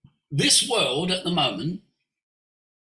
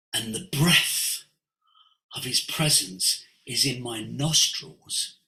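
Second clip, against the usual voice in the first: solemn scripture quote; 6 semitones lower than usual. Retold or imitated. Teacher reciting Job's words to listeners, solemn and low. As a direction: recite it gradually lower, with long pauses.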